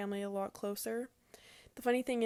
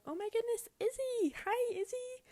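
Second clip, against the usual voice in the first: much higher, sing-song delighted greeting; baby-talk greeting; 14 semitones higher than usual. Retold or imitated. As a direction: coo like a toddler spotting her aunt. Niece greets her excitedly.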